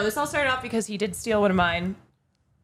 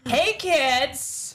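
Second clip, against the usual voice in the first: put-on higher, drawn-out kids' pitchman; mock ad pitch; 5 semitones higher than usual. Parody sponsor addressing kids, cheesy higher-pitched pitch. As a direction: call out like a cheesy advert.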